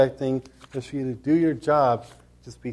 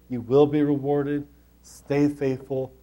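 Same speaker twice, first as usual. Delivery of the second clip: usual speaking voice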